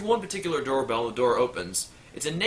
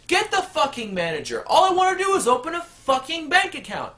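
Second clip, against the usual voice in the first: much higher, louder shouting customer; furious yell; 13 semitones higher, 5 dB louder than usual. Acted. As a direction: scream it across the bank. Customer yells at teller.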